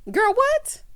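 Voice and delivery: high, loud disbelieving outburst; incredulous. Real, not acted. Reacts in disbelief to ankle compliment.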